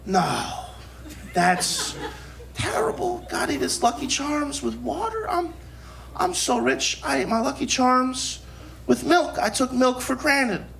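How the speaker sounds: hushed, hammed-up tearful whimper rising high; mock crying. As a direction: imitate overcome kids on the verge of sobbing. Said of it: "they're crying, and they're like".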